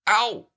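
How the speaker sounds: high, loud sharp yelp; yelp of pain. A narrator's reading. Pooh grazed by Christopher Robin's shot, sharp yelp.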